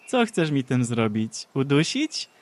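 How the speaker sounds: loud, slow taunting sneer; mock her feeble spell. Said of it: "drwiła kobieta z Aszery".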